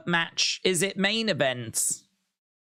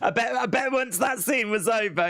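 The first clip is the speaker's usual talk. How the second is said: excited shout; 7 semitones higher than usual. Real, not acted. Excited shout about wrestling scene.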